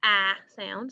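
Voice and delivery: "ah" drawn out as a clear open short a; short a sound. Shown as a demonstration. Teacher models the short a for her class, drawn out "ah". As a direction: sound out "ah" like the a in cat. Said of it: "It makes the short A".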